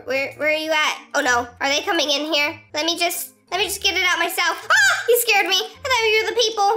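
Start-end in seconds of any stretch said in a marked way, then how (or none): 4.7-5.0 short high shriek of fright; sudden high yelp; startled scream. Real, not acted. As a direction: jump out of your skin. Teammate startles her.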